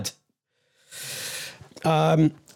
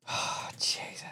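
breathy exhausted whisper under the breath; whispered sigh; 14 dB quieter, slower than usual. Real, not acted. Host overwhelmed, whispering to himself. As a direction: sigh it out in a near-silent whisper.

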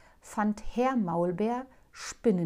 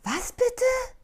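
high, startled squeak; jolted awake; shocked high voice; 6 dB louder than usual. Acted. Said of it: "fuhr erschrocken aus dem Bett".

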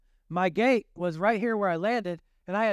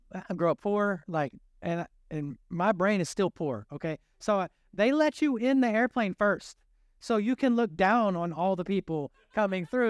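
usual speaking voice

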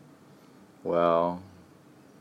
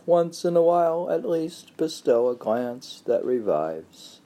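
high, lifted recital voice; poetry reading; 10 semitones higher, 4 dB louder than usual. Acted. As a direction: recite it with feeling. Reads poem aloud.

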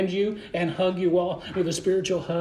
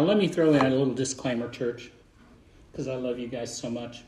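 ordinary talk, nothing marked

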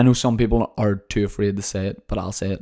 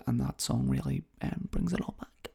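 whisper; 3 semitones higher, 10 dB quieter than usual. Real, not acted. Fond childhood memory.

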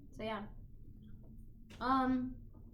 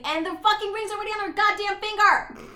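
loud, high, incredulous rant; outraged exclamation; 8 semitones higher, 9 dB louder than usual. Real, not acted. Viewer appalled the ring's already on, loud outrage. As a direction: build to a loud, raised finish.

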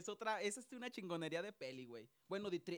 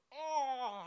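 higher, louder mock yell; imitated scream; 5 semitones higher, 5 dB louder than usual. Imitating the film kid for his friend, raised mock scream. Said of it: "¿por qué grita?".

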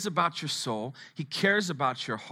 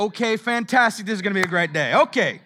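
louder, higher burst of preacher enthusiasm; excited hype; 6 semitones higher, 8 dB louder than usual. Real, not acted. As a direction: fire up the crowd. Preacher hypes the congregation.

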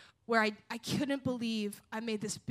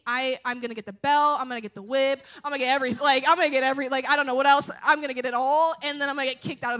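louder and louder, panicked, rattling off the punishments; a scared kid imagining every punishment, getting louder; frantic loud listing; 8 dB louder, faster than usual. Retold or imitated.

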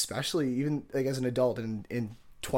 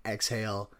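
normal talking voice

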